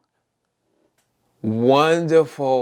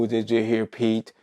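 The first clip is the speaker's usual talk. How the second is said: ordinary talk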